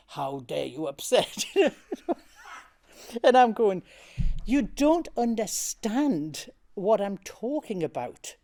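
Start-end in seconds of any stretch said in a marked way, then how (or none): 0.1-1.4 low-pitched mimicry of a relayed scolding; mock interpreter repeating a telling-off; interpreter's echo. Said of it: "Michael's going". 4.4-8.4 raised, insistent arguing back; indignant retort. Retold or imitated. Acted argument with director.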